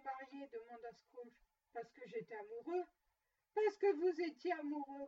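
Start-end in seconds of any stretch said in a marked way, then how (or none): 3.5-5.1 loud, scornful echo; grumbled in loud disbelief; indignant grumble. A narrator's reading. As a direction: throw the words back, louder. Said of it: "grommela Scrooge".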